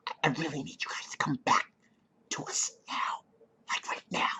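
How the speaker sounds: breathy hushed pleading whisper; Lydia's whisper. Retold or imitated. Imitating Lydia begging the dealers in a whisper. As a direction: whisper it urgently, almost pleading. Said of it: "I like the way she talks. It's sort of annoying, but it's cute".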